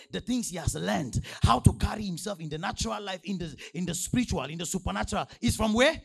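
loud, driving preacher's delivery; emphatic preaching. Real, not acted. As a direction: build the point forcefully for the congregation. Preaches at full force.

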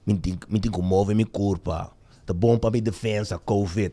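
low mumbling mock Spanish; mumbled gibberish Spanish. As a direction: mutter it low like a big prisoner. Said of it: "hij mompelde iets in de trend van".

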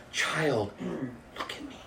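soft, hushed tender plea; God calling softly to a child in the mire. Acted. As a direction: speak to a child gently. God's tender call.

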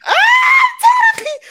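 high loud fake cry; mock wailing. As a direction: wail it like a crybaby. Wailing about bladder, laughing.

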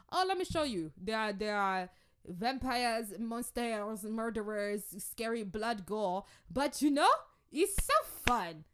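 loud, drawn-out mock excitement; hammed-up enthusiasm. Churchgoer selling scary Halloween to a newcomer, hammed-up enthusiasm.

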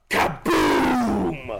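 game announcer. Retold or imitated. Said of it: "they also say".

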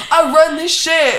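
high, loud laughing yell; screaming. Retold or imitated. Filming herself behind the counter, gleeful screaming. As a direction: shout it like you own the city. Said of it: "I start screaming".

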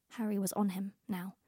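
usual speaking voice

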